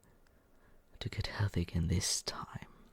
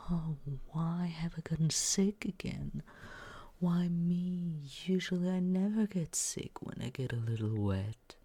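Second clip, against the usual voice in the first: higher whispered whiny imitation of the sick friend; mock whining; 8 semitones higher than usual. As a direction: parody their self-pity in a higher, breathy whine. Mocking the sick roommate's whining.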